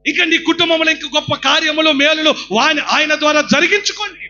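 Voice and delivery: loud, high preaching cry; fervent sermon shout; full-force preaching. Acted. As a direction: exhort the crowd with all your voice. Preacher exhorting congregation.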